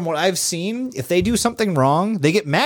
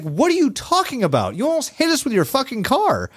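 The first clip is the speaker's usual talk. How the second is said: retold outburst; 10 semitones higher than usual. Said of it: "And I'm just like".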